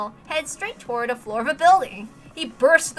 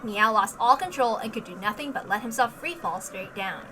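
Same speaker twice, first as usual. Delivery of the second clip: usual speaking voice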